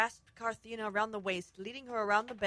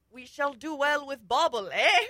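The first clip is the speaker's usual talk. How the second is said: louder, rising into a high 'eh?'; hero's proud declaration; 4 semitones higher, 9 dB louder than usual. Acted. Hero holds up the gem, hammy declaration. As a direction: declaim it, tag question jumping up. Hero's line acted with flourish.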